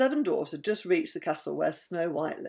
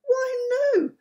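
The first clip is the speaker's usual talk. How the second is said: high, loud princess protest; cried out; 6 dB louder than usual. A narrator's reading. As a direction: exclaim it high in quick defence.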